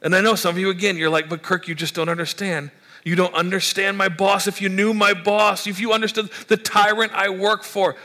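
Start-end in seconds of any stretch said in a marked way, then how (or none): 1.3-8.0 whiny complaint. Acted. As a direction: play the fed-up employee griping. Congregant complaining about tyrant boss.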